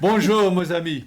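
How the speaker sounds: hammed-up French greeting; French accent.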